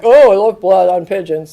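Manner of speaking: loud, put-on pompous priest voice; mock priest loving blood offerings. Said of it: "the big kahuna is like".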